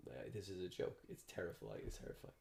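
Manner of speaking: hushed, fading to a whisper; whispered fear. Retold or imitated. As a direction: breathe it out, scared. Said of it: "So I'm like".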